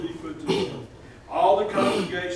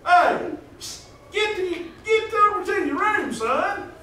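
put-on high, loud shout to shoo a boy off; mock counselor yell; 10 semitones higher, 4 dB louder than usual. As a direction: bark a kid back to his room, half joking. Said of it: "and I said".

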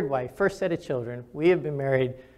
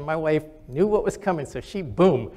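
ordinary talk

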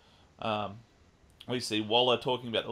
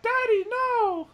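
put-on much higher, slow child voice crying out; little girl's plea; 6 dB louder, much slower than usual. Daughter stepping between Deadshot and Batman, high slow child's cry. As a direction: cry out like a small girl. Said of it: "And she's like".

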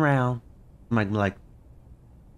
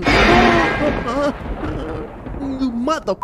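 long high scream, much louder; terrified scream; 4 dB louder, much slower than usual. Real, not acted. Gamer spotting the monster, terrified high scream. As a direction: shriek in terror.

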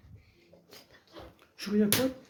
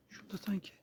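whispering; 16 dB quieter than usual. Pretend. Sneaking, spotting something.

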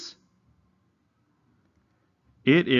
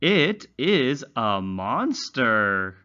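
slow, high, sing-song storybook reading; reading to children; 3 semitones higher, much slower than usual. Acted. Teacher reading a simple story to young English learners, slow and sing-song. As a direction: read it slowly like a picture book for little ones.

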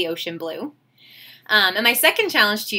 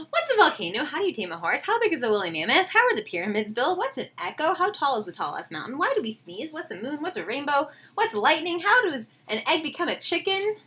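lively, higher-pitched run of wondering questions; curious questions; 5 semitones higher, 3 dB quieter than usual. A narrator's reading. Teacher reading the child's endless questions to her class, curious lilt. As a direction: rattle off questions like a curious kid.

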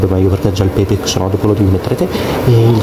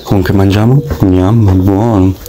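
chewing while talking; speaking between bites of pasta; mouth full. Real, not acted.

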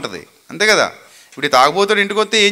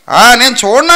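God refusing; 3 semitones higher, 9 dB louder than usual. Retold or imitated. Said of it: "అంటున్నాడు".

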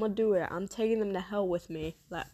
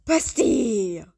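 loud dismayed cry of the name; shocked cry; 8 dB louder than usual. Real, not acted. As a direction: call out in disbelief, loud. Friend revealed as suspect.

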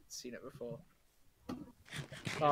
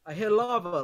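excited; 6 semitones higher, 8 dB louder than usual. Real, not acted. Echoes the zombie call-out.

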